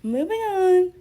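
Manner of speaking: jumps high and loud on moving on; hammed-up brisk change of subject; mock moving on. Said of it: "Me after saying anything".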